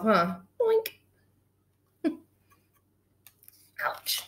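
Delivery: ordinary talk, nothing marked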